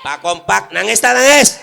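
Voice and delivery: rising from loud to a high yelled question; preacher hurling the question at the crowd; building shout. Acted. Preacher quizzes the audience, building.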